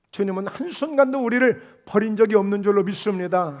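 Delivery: normal talking voice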